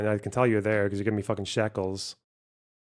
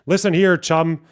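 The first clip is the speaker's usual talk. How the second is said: louder, raised pompous lecture voice; hammed-up condescending bro; mock lecturing dude; 4 semitones higher, 7 dB louder than usual. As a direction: lord it over them like a know-it-all. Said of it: "Being like".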